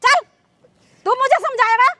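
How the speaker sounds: outraged mother's tirade; scornful, indignant scolding; angry scolding. Acted. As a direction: berate him with indignant questions. Acted mother dismisses the man.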